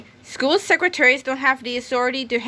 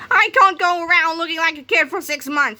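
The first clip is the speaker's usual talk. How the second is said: angry rant; 7 semitones higher, 4 dB louder than usual. Acted. Principal ranting as kid.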